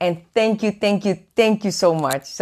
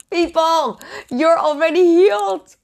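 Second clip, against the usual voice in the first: fervent proclamation; 7 semitones higher than usual. Real, not acted. Preacher telling viewers they're healed, fervent and raised. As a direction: proclaim it with conviction.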